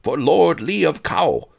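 loud, high, pompous proclamation; bellowing. A narrator's reading. Major domo announces his lord to the miser, bellowing. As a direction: proclaim it like a herald to the street. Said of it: "he bellowed".

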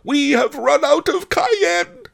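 loud wail in piercingly high falsetto; Fat Fu's wail. A narrator's reading. Wife reporting the cayenne is gone, loud high wail. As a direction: wail it at the top of the voice, hammed-up. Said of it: "wailed Fat Fu".

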